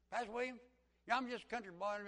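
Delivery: put-on high, loud country boy; country accent. Country man raising his hand in class, country accent. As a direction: pipe up like a country fellow. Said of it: "he just talked like this".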